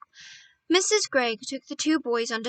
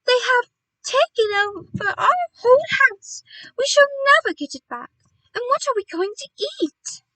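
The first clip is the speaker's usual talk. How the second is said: high, loud, panicky lament; distressed wail; 12 semitones higher, 8 dB louder than usual. A narrator's reading. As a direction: wail on at high pitch in dismay. Mother bird laments lost house.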